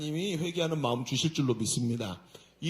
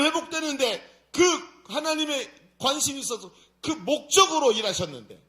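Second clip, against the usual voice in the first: high, loud sermon delivery; forceful preacher's sustained raised voice; impassioned preaching; 8 semitones higher, 9 dB louder than usual. Real, not acted. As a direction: drive the point home to the congregation.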